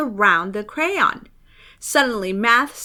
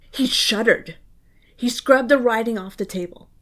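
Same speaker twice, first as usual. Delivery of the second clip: ordinary delivery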